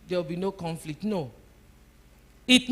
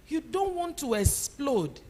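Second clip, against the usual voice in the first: normal talking voice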